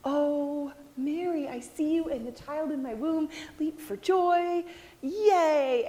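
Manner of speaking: mock-sweet Christmas pageant greeting; sweet lovely version. Elizabeth greets Mary, mock sweet pageant tone. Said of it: "it's this really lovely moment".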